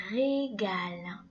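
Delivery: "régale" drawn out slowly; slow syllables. Shown as a demonstration. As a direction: slowly spell out "régale" by sound. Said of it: "Écoute bien chaque syllabe".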